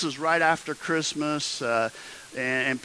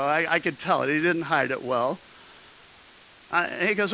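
ordinary talk, nothing marked

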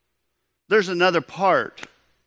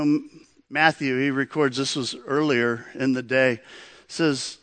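ordinary talk

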